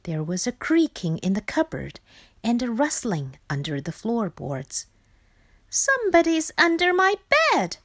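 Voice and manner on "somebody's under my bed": high, quick, alarmed little voice; frightened Frog. Acted. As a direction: voice the scared thought high and fast. Frightened Frog thinks aloud in bed.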